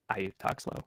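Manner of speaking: robotic voice. Real, not acted. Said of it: "you sound robotic".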